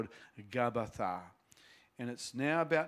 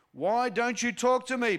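high, loud demand; 10 semitones higher, 8 dB louder than usual. A narrator's reading. Pilate demanding an answer from Jesus, loud. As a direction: force an answer out of him. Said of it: "Pilate demanded".

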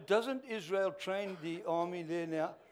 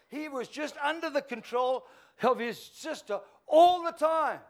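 fiery preaching; 8 semitones higher, 9 dB louder than usual. A narrator's reading. Preaching the story at full force.

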